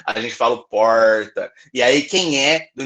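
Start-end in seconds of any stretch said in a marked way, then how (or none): 0.7-1.5 "porta" with a curled, growled R from inland São Paulo; growled interior R. Shown as a demonstration. Teacher showing students the inland São Paulo R in "porta". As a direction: curl the tongue back on the R of "porta". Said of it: "no interior de São Paulo, a gente fala".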